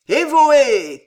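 high, loud drawn-out cheer; crying out. A narrator's reading. Poets hailing their god at the rite, crying out. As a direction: shout it like a ritual cry. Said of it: "crièrent les poètes".

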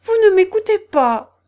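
indignant storybook mouse telling off; severe scolding. A narrator's reading. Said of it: "d'un air sévère".

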